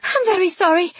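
high-pitched, flustered apology; embarrassed apology. A narrator's reading. Bronte backing away after kissing him, embarrassed. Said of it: "giving an embarrassed cry".

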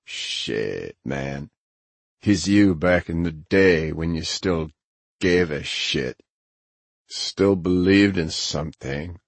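gruff friend's slow, knowing drawl; Grinner, amused and knowing. Acted.

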